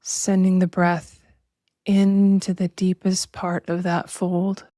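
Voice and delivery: slow, measured meditation voice with long pauses; drawn-out, even-toned relaxation guidance; guided meditation. Acted. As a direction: guide listeners unhurriedly into body awareness.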